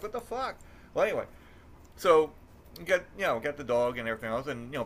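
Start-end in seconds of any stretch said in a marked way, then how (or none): 0.0-0.6 mock disbelief. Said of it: "I'm like".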